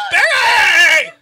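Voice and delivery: loud, high-pitched scream for the wife; screaming for help. Retold or imitated. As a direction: scream it out at full force, reenacting panic. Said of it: "I'm screaming my ass off".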